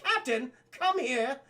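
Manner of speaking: put-on princess voice, high and slow; imperious princess. Princess calls over the Captain of the Guard, imperious. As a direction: summon him like haughty royalty. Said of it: "but the princess, she calls over".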